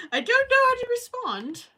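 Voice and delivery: words spilling out through laughter, high and loud; laughing. Real, not acted. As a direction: laugh helplessly as you say it. Laughing at chat comment.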